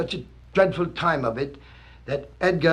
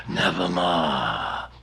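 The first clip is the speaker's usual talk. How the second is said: much lower, slow raven croak; ominous raven; 11 semitones lower, 3 dB quieter, much slower than usual. Acted. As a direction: intone it like a doom bird. Raven's reply, deep and slow.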